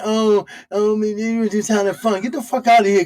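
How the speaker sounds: ordinary delivery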